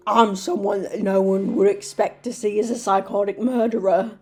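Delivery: overdone British villain line; bad British accent. Reader voicing Harry in a bad British accent. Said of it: "my bad British accent".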